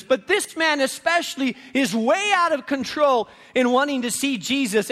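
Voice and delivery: usual speaking voice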